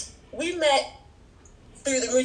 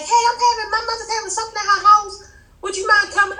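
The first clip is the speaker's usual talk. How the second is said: lightly imitated friend, higher-pitched; friend's tentative invitation; 9 semitones higher, faster than usual. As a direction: voice a friend politely asking a favor. Friend's invitation voiced in story.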